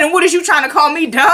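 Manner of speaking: high, fast, laughing retort; laughing mock offence. [name] challenging him for calling her dumb, mock-offended. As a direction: act offended through laughter.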